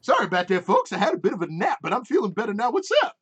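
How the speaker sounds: put-on breezy apology from a rowdy old man; cheery old gnome. Old gnome greeting the passengers, a hint of cheerful old-timer. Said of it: "and he's just like".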